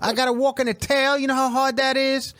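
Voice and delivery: high, put-on confrontational voice; Bahad Bahabi. Imitating her confronting a clam underwater, mock-indignant. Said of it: "So Bahad Bahabi's down there at the bottom of the ocean going".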